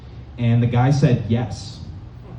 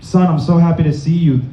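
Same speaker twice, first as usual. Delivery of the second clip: lightly acted worked-up dad, higher pitch; mock alarmed dad; 4 semitones higher than usual. Doomsday dad greeting his son with old news, mock alarm. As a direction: play an over-eager dad raising the alarm. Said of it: "He's like".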